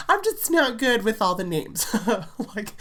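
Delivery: giggling through the words; laughing. Real, not acted. Vlogger laughing at her own botched place names, giggling. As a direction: laugh it off, embarrassed.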